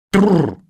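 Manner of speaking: loud rolled trilled "trrr"; понукание осла. Acted. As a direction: roll it out to drive a donkey. (раскатистое «тррр»)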